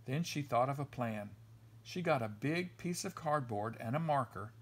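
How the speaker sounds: usual speaking voice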